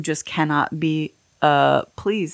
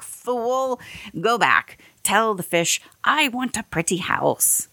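higher, put-on voice of a bossy wife; demanding wife voice; 6 semitones higher than usual. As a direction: order the husband back out like a greedy wife. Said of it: "almost dead on the voice".

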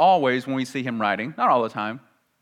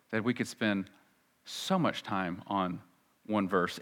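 normal talking voice

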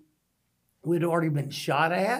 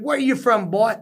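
general's bark; 6 semitones higher, 8 dB louder than usual. Retold or imitated. General confronting him, retold.

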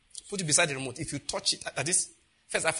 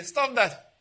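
stern stop command; 5 semitones higher than usual. Shown as a demonstration. Father snapping at his misbehaving child, firm command.

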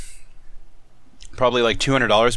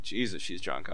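muttered aside; 5 semitones lower, 11 dB quieter than usual. Retold or imitated. Father-in-law leans over muttering.

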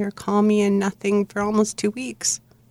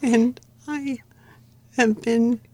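higher, halting, holding back emotion; choked up; 3 semitones higher, slower than usual. Real, not acted. Daughter telling of love for her father, choked up. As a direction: push on through a tight throat. Still moved after the memory.

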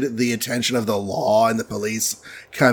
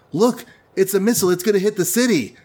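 alarmed; 7 semitones higher, much faster than usual. Retold or imitated. Hulk spots incoming missile.

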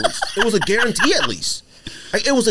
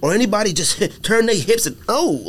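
usual speaking voice

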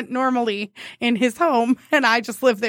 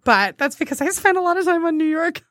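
ordinary delivery

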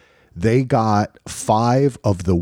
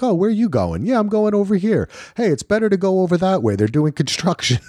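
high, chummy mock local; friendly New Yorker; 10 semitones higher, faster than usual. The stranger from the corner, now chatty, in a high mock voice. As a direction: play the helpful New Yorker giving directions. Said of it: "They're like".

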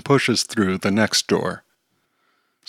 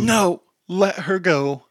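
higher, slow; defeated order; 9 semitones higher, slower than usual. Acted. Teacher telling the sloth to release the girl, higher and slow. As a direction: give in and release her.

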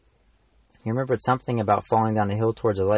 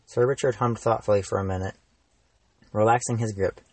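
usual speaking voice

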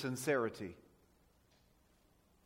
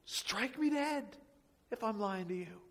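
cursing himself; 5 semitones higher than usual. Acted. Peter calls down curses on himself, high-pitched and insistent. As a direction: draw out the oath in a raised pitch. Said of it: "He's calling down curses on himself".

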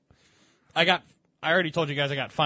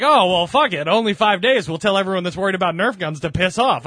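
booming, louder and higher imitation; loud mock official; 4 semitones higher, 8 dB louder than usual. Police officials brushing off Nerf gun worries, loud and higher. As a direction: ham up the relieved official. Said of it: "they're like".

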